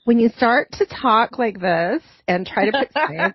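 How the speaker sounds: exaggerated mock Valley Girl lilt; Valley Girl accent. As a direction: imitate a Valley Girl explaining science. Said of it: "That is a Valley Girl accent".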